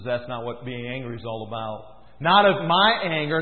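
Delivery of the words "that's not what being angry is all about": low, soft, calm correction; God gently setting him straight; lightly voiced God, low and quiet. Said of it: "He says".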